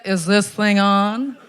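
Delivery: slow comic mic-check voice; funny-guy boyfriend. Retold or imitated. Imitating her jokey lover in bed, slow mic-check delivery. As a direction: draw it out like a comedian testing a mic. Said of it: "he'd be like".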